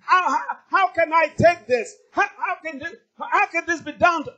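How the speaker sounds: loud, high, frantic shouting at God; half-shouted protest, pitch up high; desperate outcry. Acted. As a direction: cry out like you can't take it. Acted outburst at God.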